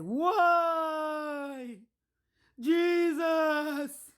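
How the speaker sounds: imitating his loud, high wailing cry; mock wailing. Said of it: "This man started to cry".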